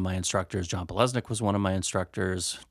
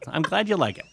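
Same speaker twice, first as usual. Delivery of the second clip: laughing mock praise; 6 semitones higher, faster than usual.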